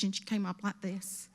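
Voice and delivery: ordinary talk, nothing marked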